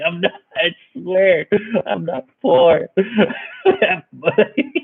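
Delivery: high, drawn-out insistence through laughter; laughing mock protest. Teased storyteller after everyone called him poor, laughing mock protest. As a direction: crack up while pleading your case.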